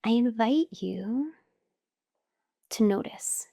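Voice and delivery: slow, measured meditation voice with long pauses; guided meditation. Acted. Teacher guiding listeners to notice their breath, calm meditation voice. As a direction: guide them unhurriedly through each breath, pausing between phrases.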